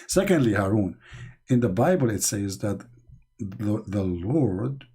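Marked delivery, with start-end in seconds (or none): none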